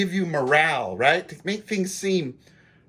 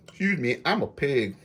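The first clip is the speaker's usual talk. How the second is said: talking through a mouthful of food; words round a bite of food; mouth full; 5 semitones lower, 4 dB quieter than usual. Real, not acted. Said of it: "Talking with my mouth full".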